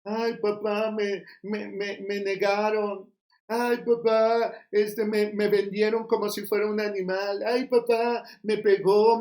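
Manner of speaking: Jesus mockingly whining to his father; mock whining Jesus. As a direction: whine ay papá like a crybaby. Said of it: "Jesús se puso a lloriquear".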